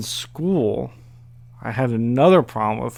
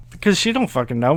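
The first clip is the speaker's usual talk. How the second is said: ordinary talk, nothing marked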